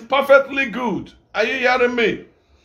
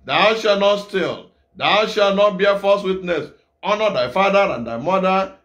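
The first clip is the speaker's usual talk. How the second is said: solemn recitation; 2 semitones lower than usual. Acted. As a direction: intone each commandment deliberately. Recites commandments slowly.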